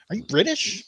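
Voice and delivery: lightly mimicked American, high rising question; curious American tourist. People in America asking where he is from, curious mock-tourist lilt.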